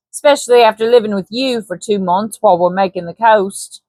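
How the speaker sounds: light Cockney trader voice; Cockney Hanson. Acted. Hanson flatters Baines, light Cockney persuasion.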